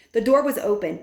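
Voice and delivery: plain reading voice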